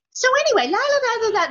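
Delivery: very high, singsong blithe prattle; mock breezy chatter. Co-star imitates her switching off after cut, mock breezy. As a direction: toss it off high and carefree.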